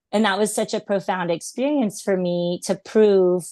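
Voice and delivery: ordinary talk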